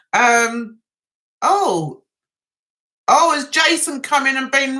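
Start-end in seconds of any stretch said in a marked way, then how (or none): none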